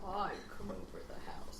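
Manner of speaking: breathy mock-sexy whisper. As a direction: whisper it seductively. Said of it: "What's your sexy voice then?".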